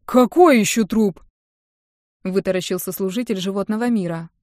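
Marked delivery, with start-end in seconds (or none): 0.1-1.2 loud, slower alarmed question; aghast old man; startled old guard, loud and slow; shocked guard. Acted.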